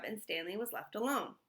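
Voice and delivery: ordinary delivery, nothing marked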